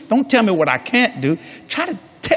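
ordinary delivery

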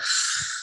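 long calming breath out; deep audible exhale. Shown as a demonstration. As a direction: model slow breathing for the class. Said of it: "then we would breathe together".

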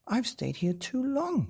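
put-on high, anxious cry of a girl. A narrator's reading. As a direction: cry out that she has stayed too long. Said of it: "she cried".

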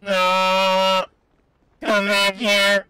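hammed-up space invader hunting a fleeing human; mock alien yelling come back here; alien chaser. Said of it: "sound like we're from another planet".

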